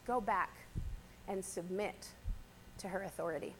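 a hint of a low, soft solemn command; low and hushed, like a grave messenger; solemn angel. Angel ordering Hagar back, low and hushed. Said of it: "the angel of the Lord says".

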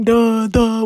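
loud, dopey mocking duh; mock a dimwit, loudly; Patrick. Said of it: "You're fucking Patrick".

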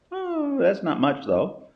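high, slow mock-dismissive; ironic. Lecturer joking that a terabyte is small, high and ironic. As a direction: pretend a terabyte is nothing.

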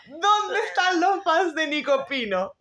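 high, slow hyped announcer. Retold or imitated. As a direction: call out to the crowd like an MC. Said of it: "el animador va y dice". (voz de animador entusiasta)

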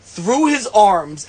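ordinary talk, nothing marked

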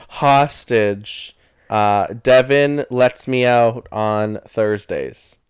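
loud, slow script reading; mock hostage statement. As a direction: recite it loudly like a coached hostage.

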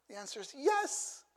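put-on high, bright child's answer; a child's quick, high yes; eager yes. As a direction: answer like a happy little kid.

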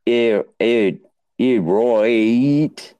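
lightly put-on Australian "Ew, ew, ew, right"; mock Australian. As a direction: clip the ends Aussie style. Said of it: "So you have to go like".

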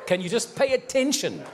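a tired angel pleading, half laughing; exasperated angel. Retold or imitated. Angel scolding the distracted driver, amused and exasperated.

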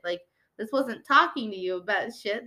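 ordinary talk, nothing marked

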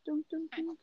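very soft, high mock knock; whisper-quiet knock effect; hushed radio-show knock; soft knocking sound. Imitating a door knock again.

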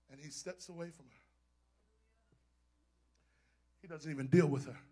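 soft, suspenseful sermon with long pauses; hushed preaching. Real, not acted. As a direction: drop to a hush and let every phrase hang. Hushed preaching after the climax.

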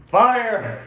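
yelled alarm. Acted. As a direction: call it out to rouse the sleepers. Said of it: "I yell".

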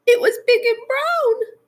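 tiny, very high mouse voice; frightened mouse. Acted. Mouse reporting to Frog, frightened high cry. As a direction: cry out as a scared little mouse. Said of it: "cried the mouse".

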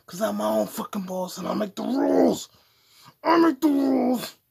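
high, loud, hammed-up declaration; defiant shout. Podcaster declaring himself his own boss, defiant shouting. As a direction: yell it like a mock tyrant.

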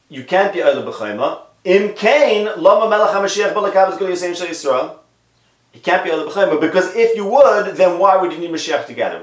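high, loud animated reading; expressive reading. Shown as a demonstration. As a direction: read it with full rhetorical drive. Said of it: "I'll just tell you the words".

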